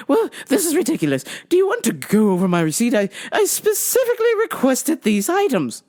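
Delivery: high, indignant English caller; brisk, huffy mock-English complaint; phony English accent. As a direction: protest in put-on English. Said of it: "phony English accent".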